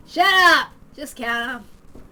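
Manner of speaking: loud snap, then slow hard order; explode, then drag out the command; yelling. Acted. Man yelling at his woman to count the cash, loud then slow. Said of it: "The man yelled at the woman".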